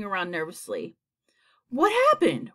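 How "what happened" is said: high, loud startled question; alarmed bewilderment. A narrator's reading. Creatures blinking nervously in sunlight, alarmed.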